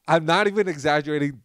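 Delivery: ordinary talk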